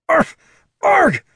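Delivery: sharp, high choking barks; barking cough. Acted. Kobold choking on a cigarette, barking coughs. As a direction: hack it out like something's stuck in your throat. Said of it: "could only manage a barking cough".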